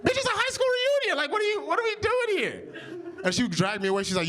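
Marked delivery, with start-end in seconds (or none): 0.0-2.7 very high-pitched, exasperated retort; exasperated protest. Retold or imitated. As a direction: squeal in exaggerated disbelief at your girlfriend. Acted quarrel with his girlfriend.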